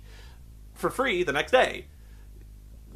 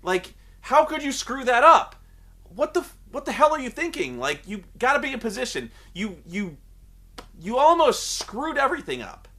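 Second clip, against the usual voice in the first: raised, louder, berating an idiot ref; berating the referee; 6 semitones higher, 3 dB louder than usual. Retold or imitated. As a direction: chew him out, pitch up and loud. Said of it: "he's berating the guy".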